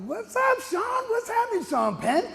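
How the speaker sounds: very high-pitched playful call-out; whooping, teasing hello; hollered greeting. Retold or imitated. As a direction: hail your buddy in an excited high holler. Calling out greeting on pulling up.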